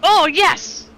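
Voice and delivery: loud, high triumphant shout; excited cheer. Real, not acted. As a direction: cheer the big hit at full voice. Player cheers a big hit.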